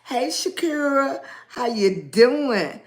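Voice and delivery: ordinary talk, nothing marked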